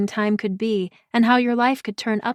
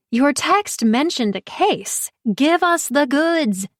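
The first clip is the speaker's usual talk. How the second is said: higher, slow, pushy friend demanding the gossip; eager Pri; 6 semitones higher, slower than usual. Acted. Pri presses her friend for the case, higher and pushy. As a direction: lean in and drag the news out of her. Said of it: "Pri said".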